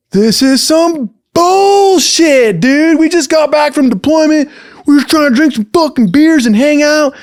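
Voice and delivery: very high, loud yell of complaint; drunken outburst. Retold or imitated. Drunk man to cop from the back seat, indignant yelling. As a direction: shout the grievance out, way up in pitch.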